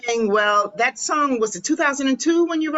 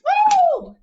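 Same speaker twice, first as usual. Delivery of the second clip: excited whoop; 4 dB louder than usual. Real, not acted. Host cheering after singing.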